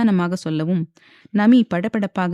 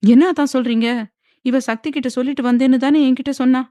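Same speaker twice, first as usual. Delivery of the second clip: shocked disbelief; 6 semitones higher, 5 dB louder than usual. A narrator's reading. Mother shocked at the news.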